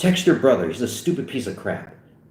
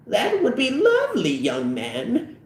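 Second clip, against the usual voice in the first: put-on very high falsetto old lady; delighted little-old-lady voice dropping at the end; 13 semitones higher, slower than usual. Old lady in fantasy answers.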